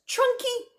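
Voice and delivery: ordinary delivery, nothing marked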